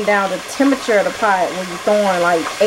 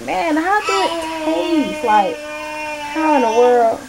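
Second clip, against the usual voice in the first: wondering aloud; 8 semitones higher, slower than usual. Retold or imitated. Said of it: "I was like".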